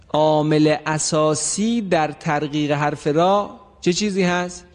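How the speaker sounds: ordinary talk, nothing marked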